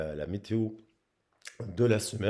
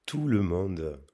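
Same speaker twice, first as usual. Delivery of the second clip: suave voice; much slower than usual. Shown as a demonstration. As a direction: draw the hello out gently. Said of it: "prendre ma voix suave".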